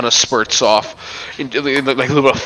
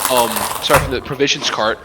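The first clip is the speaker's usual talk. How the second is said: ordinary delivery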